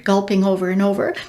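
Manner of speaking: usual speaking voice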